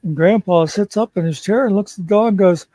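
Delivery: normal talking voice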